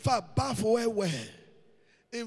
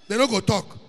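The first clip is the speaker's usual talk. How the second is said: ordinary delivery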